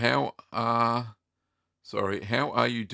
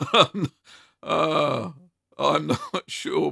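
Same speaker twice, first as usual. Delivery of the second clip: laughing through the words, higher pitched; real laughing; 7 semitones higher, 4 dB louder, slower than usual. Real, not acted. Host tickled by the way a viewer wrote a question, laughing. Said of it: "sorry for laughing".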